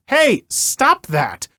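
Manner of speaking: high, slow drawn-out call to stop; calling to a man slamming the door; half-mock yell from a distance; retold yell. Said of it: "So at that point I yelled".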